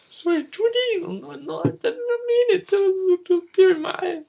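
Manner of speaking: high, whiny pretend sorry; hammed-up sobbing plea to the teacher; mock tearful apology. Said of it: "I go".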